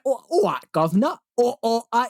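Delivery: high, badly faked Cockney; over-the-top mock Cockney. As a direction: parody an American overdoing a Cockney accent. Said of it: "like, the over Cockney".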